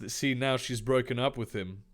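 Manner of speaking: ordinary talk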